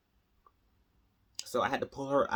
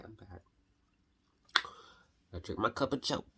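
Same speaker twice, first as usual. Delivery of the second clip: ordinary talk, nothing marked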